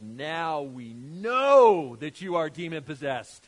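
theatrical accusing shouts; hammed-up exclaiming. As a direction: play an indignant crowd pouncing on proof.

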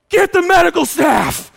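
high, loud retold command; urgent shout. Wife in labour ordering staff, high urgent shout. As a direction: bark for help in labour. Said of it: "Susie's like".

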